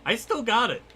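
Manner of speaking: high-pitched proud nerd; nerdy voice. Pretend. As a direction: squeak it out smugly. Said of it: "done the nerdy voice".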